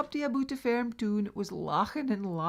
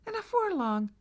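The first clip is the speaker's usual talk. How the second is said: ordinary delivery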